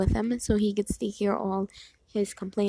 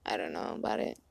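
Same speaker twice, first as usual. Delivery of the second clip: lightly voiced sister, low and soft; a low, quiet imitation of an unsure kid; imitated little sister; 5 dB quieter than usual. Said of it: "My sister's little, so she's like".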